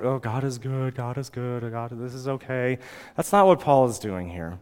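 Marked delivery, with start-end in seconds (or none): 0.0-2.8 soft mock-serene murmur; detached recital. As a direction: recite it passively, soothing yourself. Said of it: "try to achieve some kind of serenity, some detachment".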